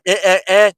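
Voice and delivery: loud mock "eh" bleats; high, loud inarticulate grunts. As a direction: imitate slurred speech as bare "eh" noises. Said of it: "that sounds crazy".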